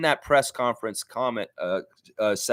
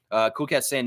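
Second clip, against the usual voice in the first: usual speaking voice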